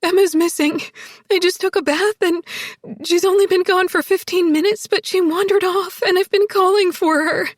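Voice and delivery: high, loud, panicked tearful report; sobbing while talking. A narrator's reading. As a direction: pour it out through sobs. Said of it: "sobbing and talking at the same time".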